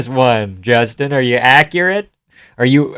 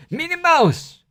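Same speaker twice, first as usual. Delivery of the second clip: high squeaky Merab voice; Merab impression; slower than usual. Streamer mimicking the fighter Merab, high-pitched. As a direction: pitch way up into a squeaky Georgian accent. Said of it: "the Marab impression's pretty good".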